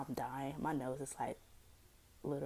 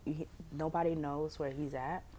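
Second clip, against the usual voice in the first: usual speaking voice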